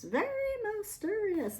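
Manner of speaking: mysterious rising on mysterious; high swooping. Real, not acted. Repeats words with high swoop.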